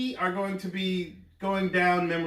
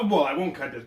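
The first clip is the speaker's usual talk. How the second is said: normal talking voice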